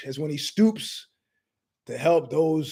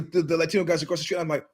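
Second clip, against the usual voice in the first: ordinary talk, nothing marked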